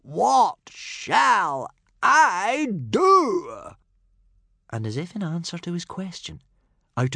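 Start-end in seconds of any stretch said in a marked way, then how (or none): 0.0-3.8 high, loud, drawn-out wail; despairing cry to the sky; frustrated howl. A narrator's reading. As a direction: howl it at the heavens, very slowly. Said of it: "howled up to the uncaring sky".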